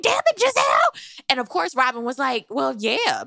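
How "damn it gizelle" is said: high-pitched exasperated cry; mock outrage. Recapper replays reaction to Gizelle's question, high mock outrage. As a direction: play up the exasperation, voice thrown high.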